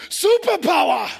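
slow, loud, beaming chant; joyful loud answer. Retold or imitated. Mali friend shouting the answer back, laughing. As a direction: belt it out with a laugh. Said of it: "He said".

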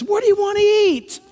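a lightly voiced, passionate diner; lightly imitating an animated Middle Eastern diner; excited chatter. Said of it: "They're talking like this".

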